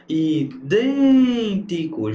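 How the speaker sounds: Idêntico very slow, with a long, swelling "dên"; Idêntico drawn out on the stressed "dên"; stress on the antepenultimate. Shown as a demonstration. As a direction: stretch the second syllable of Idêntico. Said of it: "Gente, proparoxítona".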